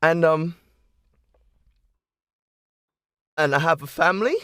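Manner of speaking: high, loud bragging; proud boast. Acted. Snow boasting of her adventures to the children, high and loud. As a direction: puff up and boast to the kids.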